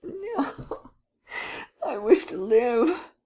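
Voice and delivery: high, frightened plea; frightened pleading. Acted. Terrified young man begs the prince at gunpoint, high and pleading. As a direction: beg for your life, voice up high.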